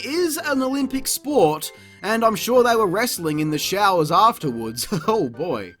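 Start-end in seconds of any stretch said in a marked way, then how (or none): none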